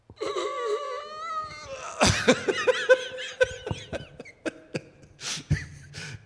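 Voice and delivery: loud, high burst of laughter. Real, not acted. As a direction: break into a big high laugh. Punchline of the story. (gargalhada alta e aguda)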